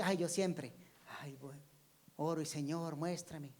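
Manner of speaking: murmured imitation of a habitual prayer; soft, trailing mock plea, half under the breath; mock praying. Believer asking the Lord to show them, softly murmured mock prayer. Mimics someone always praying for revelation.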